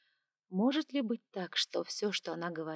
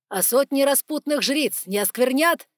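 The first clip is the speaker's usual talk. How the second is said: louder, sharp retort; raised voice; 6 semitones higher, 11 dB louder than usual. A narrator's reading. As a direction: raise your voice to hit back. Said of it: "Повысив голос".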